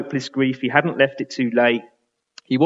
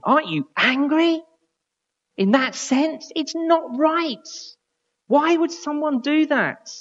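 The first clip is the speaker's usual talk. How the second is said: indignant outrage; 12 semitones higher than usual. Real, not acted. Preacher stirring outrage at a shooting.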